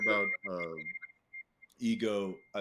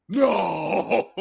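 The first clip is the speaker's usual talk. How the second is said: loud, high cry of disbelief; shocked shout; 10 semitones higher, 11 dB louder than usual. Retold or imitated. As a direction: yell it like you can't accept it. Refusing to believe, retold.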